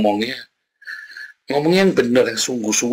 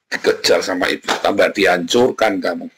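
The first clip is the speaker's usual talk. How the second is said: ordinary delivery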